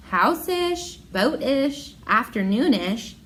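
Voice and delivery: usual speaking voice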